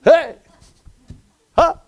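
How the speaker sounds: loud bright hey; mock cheerful greeting. A dejected person wouldn't greet this brightly. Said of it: "you don't walk around like".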